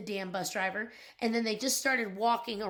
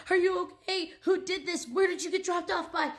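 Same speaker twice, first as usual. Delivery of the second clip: frantic yelling; 8 semitones higher than usual. Retold or imitated. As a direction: re-enact yelling in a panic at the boy. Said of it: "I am screaming like a lunatic".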